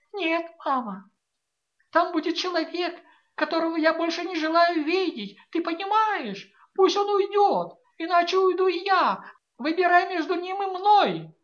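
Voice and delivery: high, loud, building ultimatum; rising theatrical voice. A narrator's reading. A daughter gives her mother an ultimatum, rising to loud. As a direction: deliver it like a stage heroine. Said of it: "постепенно повышала голос, говоря как на сцене".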